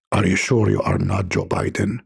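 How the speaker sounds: a cranky angel snapping down the line; gruff angel Jibreel. Acted. Angel Jibreel answering caller, gruff and annoyed.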